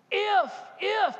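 high, loud, forceful shout; preacher at full force, shouting the word. Real, not acted. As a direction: hammer home the condition at full force. Preacher's climax on the condition.

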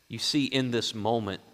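normal talking voice

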